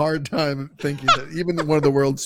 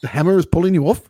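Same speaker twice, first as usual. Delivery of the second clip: put-on terrible Kiwi accent; 4 semitones higher, 5 dB louder, faster than usual. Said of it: "that's my terrible Kiwi accent".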